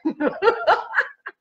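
amused laugh. Real, not acted. Laughing at ghost idea.

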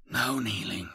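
quiet, thin and cold; soft thin voice. A narrator's reading. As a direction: speak softly, with chilling conviction. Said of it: "His voice is strangely soft".

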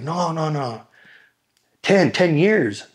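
high, drawn-out correction called back; imitated insistent calling. Correcting cheering inmates about his sentence, insistent high call. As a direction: call it back high, stretching the last words.

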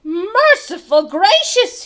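slow, high, loud exclamation; shocked gulp. A narrator's reading. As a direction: gulp it out, aghast. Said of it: "he gulped".

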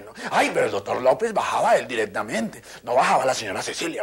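usual speaking voice

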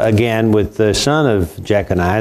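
ordinary talk

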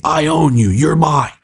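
high, brief mock scream; retold scream at ordinary volume; imitated screaming. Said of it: "He's screaming at me like that".

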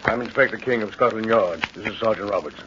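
police inspector. Acted. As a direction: introduce yourself with calm authority. Inspector introduces himself.

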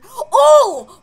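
loud, high yelp; startled cry. Real, not acted. Player sees Foxy active, startled yelp. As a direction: react with a jolt of alarm.

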